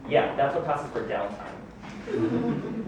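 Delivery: slow, soft, laughing ironic remark; sarcastic aside. Acted. Mocking his so-called quiet decade, a slow soft wry aside. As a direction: drag it out wryly under the breath.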